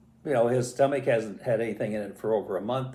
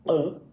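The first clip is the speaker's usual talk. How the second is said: short, higher-pitched swallowing grunt; imitated gulp; 6 semitones higher, 4 dB louder than usual. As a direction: mimic gulping something whole. Imitates iguana gulping the grape.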